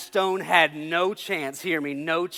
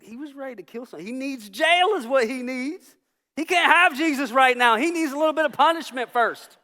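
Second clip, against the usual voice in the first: high, indignant rant, building louder; mad; 9 semitones higher, 6 dB louder than usual. Retold or imitated. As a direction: argue angrily that he deserves punishment. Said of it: "for a minute I was mad about that".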